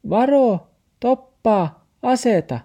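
very high, loud yelp; warning shout. A narrator's reading. The taxi warns Max on the road, high shout. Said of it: "Taksi hyppäsi ulos kaapista ja huusi".

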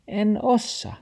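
very high little voice; talking dog. A narrator's reading. Dog makes excuses to grandfather, high-voiced. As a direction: plead inability in a high voice.

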